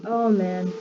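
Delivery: usual speaking voice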